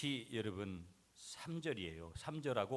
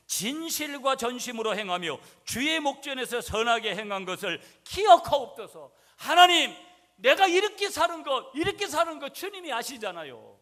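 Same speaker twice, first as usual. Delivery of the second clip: much higher and louder, pleading to God; fervent prayer shout; 13 semitones higher, 19 dB louder than usual. Real, not acted. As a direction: cry it out to the Lord. Reading scripture then praying aloud. (하나님께 높이 부르짖는 기도)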